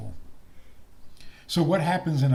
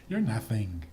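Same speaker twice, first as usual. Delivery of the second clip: normal talking voice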